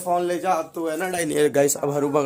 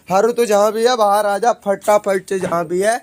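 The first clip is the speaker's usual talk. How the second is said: loud call to come out; 4 semitones higher, 10 dB louder than usual. Real, not acted. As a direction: shout across the house to him. Calling the hidden kid out.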